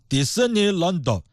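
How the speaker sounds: Disneyland said slowly in a mock Japanese accent, the light consonants hit hard. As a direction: imitate a Japanese speaker on Disneyland, every consonant heavy. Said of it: "他们一翻".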